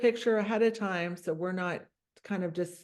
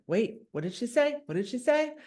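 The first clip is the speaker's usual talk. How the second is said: higher, rising questioning; mock startled listeners; 4 semitones higher than usual. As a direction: imitate a crowd suddenly paying attention. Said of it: "everyone's like".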